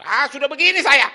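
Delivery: loud, high outburst; imitated angry yelling. Friend brushing off the pastor's advice, imitated angry shout. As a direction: snap back at full volume like a hot-tempered man. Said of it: "suka bentak-bentak".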